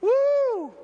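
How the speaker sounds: high, loud imitated woo; mock woo. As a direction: whoop like a Midwest crowd. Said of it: "everybody goes".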